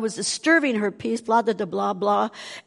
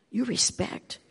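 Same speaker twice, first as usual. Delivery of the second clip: soft unvoiced whisper; 2 semitones higher, 7 dB quieter than usual. Real, not acted. Whispering a lesson to the young listeners. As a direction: breathe it as a secret.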